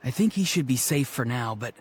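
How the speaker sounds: another character. Acted. Different deep voice speaks.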